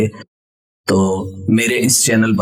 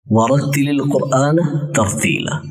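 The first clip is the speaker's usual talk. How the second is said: Quranic recitation; 2 semitones higher than usual. Acted. Quoting a Quran verse.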